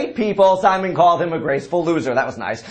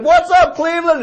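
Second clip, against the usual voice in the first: higher, louder imitation of a rocker greeting the crowd; mock stage shout; 9 semitones higher, 3 dB louder than usual. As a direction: yell it like a stadium star. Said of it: "he said".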